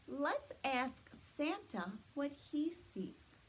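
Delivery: ordinary delivery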